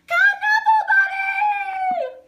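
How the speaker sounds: long, high, loud shout of the name; toddler's shout. Acted. Teacher voicing Trixie's first words, long loud high shout. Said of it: "She said her first word".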